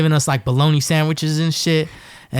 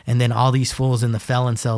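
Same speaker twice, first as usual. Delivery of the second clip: usual speaking voice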